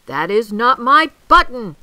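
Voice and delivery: loud, high shouted protest; angry shout. A narrator's reading. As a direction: shout it in exasperation. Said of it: "shouted Toad".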